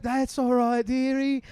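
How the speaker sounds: high soothing mum voice; mom's voice. Retold or imitated. Mum soothing her drunk daughter, high and soothing. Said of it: "She's like".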